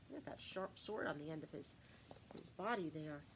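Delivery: plain reading voice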